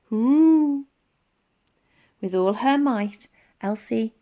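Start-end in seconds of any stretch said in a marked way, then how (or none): 0.1-0.9 high, loud, strained mammoth hoot; a big beast grunting as it falls; mammoth groan. A narrator's reading. Mammoth biting rope.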